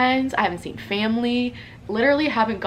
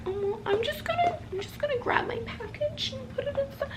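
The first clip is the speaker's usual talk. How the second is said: much higher, tiny squeaky mumble, softer; awkward weirdo voice; 9 dB quieter than usual. Retold or imitated. Grabbing the package in front of a stranger, timid high squeak. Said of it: "me being, like, a weirdo".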